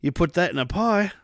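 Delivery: lightly put-on British accent; mock British accent. Friend joining the boiled-meat bit in a mock British accent. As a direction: join the bit as a British food lover. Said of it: "The British do".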